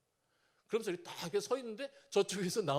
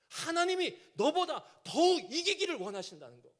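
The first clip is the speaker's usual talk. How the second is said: loud, higher, fervent pulpit climax; preaching at full force; 4 semitones higher, 6 dB louder than usual. Acted. Preacher exhorting the small and powerless, full-force preaching. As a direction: hammer home the promise to the congregation.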